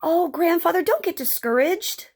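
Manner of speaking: put-on high, pleading young Joey; encouraging boy. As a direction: urge grandfather on in a high boy's voice. Boy encourages discouraged grandfather.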